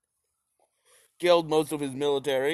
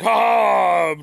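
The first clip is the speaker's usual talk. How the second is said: sudden loud shout; mock attack yell; 6 semitones higher, 9 dB louder than usual. Germans turning on the Ukrainians, loud yell. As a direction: bark a war cry. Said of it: "the Germans started being like".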